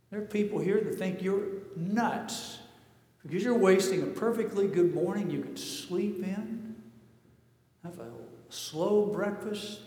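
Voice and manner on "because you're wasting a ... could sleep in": ordinary talk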